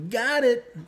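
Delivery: high squeaky giggle; giggly triumph. Real, not acted. Gamer celebrating a success with a high giggle.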